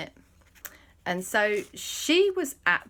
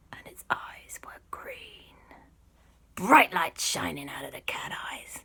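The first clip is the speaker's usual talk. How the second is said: spooky hush; 5 dB quieter than usual. A narrator's reading. Storyteller describing glowing cat eyes, spooky whisper. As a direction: narrate it in an eerie whisper.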